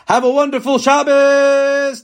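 cheerful sign-off. Real, not acted. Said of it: "cheerful sign-off".

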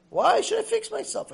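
very high-pitched indignant question; hammed-up offended pushback; acted defiant comeback. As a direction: squeal a mock protest.

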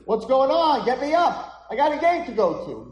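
high, loud acted yell; indignant shouting boy. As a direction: shout up urgently as an impatient boy. Hurt boy shouts from the ground.

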